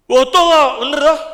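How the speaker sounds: loud, high insistence; a loud, sure-as-anything affirmation; emphatic shout. Real, not acted. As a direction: shout it out, certain and fired up. Insisting to the congregation.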